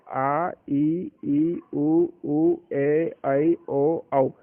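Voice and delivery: each Hindi vowel said slowly and clearly in turn; teacher reciting the ten vowels for the class. Shown as a demonstration. Teacher to students, listing each vowel deliberately. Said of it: "अब यहाँ पर जो दस स्वर आते हैं".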